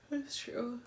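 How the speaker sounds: yawning while talking; words stretched by a yawn. Real, not acted. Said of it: "Are you yawning?".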